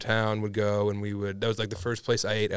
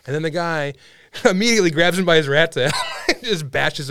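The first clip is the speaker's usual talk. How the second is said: words broken by laughter; can't stop laughing as he tells it; laughing while telling; 5 semitones higher, 5 dB louder than usual. Real, not acted. Laughing through the story.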